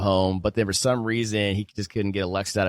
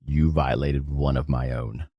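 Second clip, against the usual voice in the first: low, slow, possessive hero voice; Aiden Snow; 9 semitones lower, slower than usual. Acted. Host voicing Augustus's quote for the co-host, low and menacing. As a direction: deliver it deep and measured, a protective menace. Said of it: "I don't have Aiden Snow's voice".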